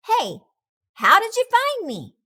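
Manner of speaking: high surprised question; shocked to be found. Acted. Surprised cat voice.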